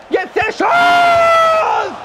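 drawn-out high yell; long shout. Real, not acted. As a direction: hold the shout. Shot in the game.

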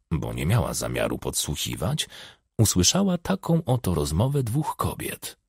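ordinary delivery, nothing marked

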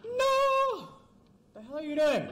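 very high cry of outrage; shrieked no. Real, not acted. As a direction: scream at the screen. Another fall.

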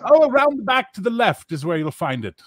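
high, chatty barkeep voice; high gossipy innkeeper; gossiping innkeeper. Acted. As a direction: grumble about foreigners, high. Innkeeper gives directions.

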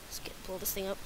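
usual speaking voice

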